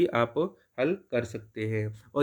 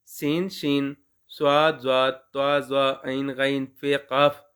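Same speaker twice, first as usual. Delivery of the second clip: slow, deliberate letter-by-letter recitation; reciting the alphabet; slower than usual. Shown as a demonstration. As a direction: spell out each letter slowly for the class. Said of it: "हम अलिफ बे पढ़ेंगे जी". (धीमे-धीमे हरूफ गिनाना)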